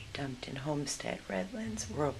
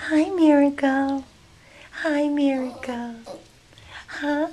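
slow, loud, much higher cooing hello; high sweet greeting; 11 semitones higher, 14 dB louder, slower than usual. Real, not acted. Rescuer greeting the puppy in high slow baby talk.

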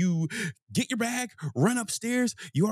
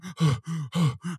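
heavy panting breath. Shown as a demonstration. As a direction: blow out air like after sprinting. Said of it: "I'm huffing and puffing".

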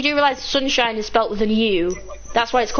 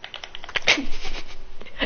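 laughing; 5 dB quieter than usual. Real, not acted. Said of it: "It was the silence that got me".

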